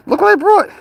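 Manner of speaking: very high, loud squeal to the pet; excited pet talk. Real, not acted. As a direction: squeal with delight at the cat.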